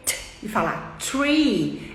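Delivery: "tree" com t duro, sem língua entre os dentes. Shown as a demonstration. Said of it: "falar com o som de t". ("tree" com som de t, sem sopro)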